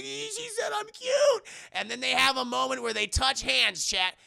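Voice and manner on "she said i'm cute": very high, drawn-out squeal; hammed-up delighted cry; mock swooning. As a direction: squeal it slowly as if overcome. Character overjoyed at compliment.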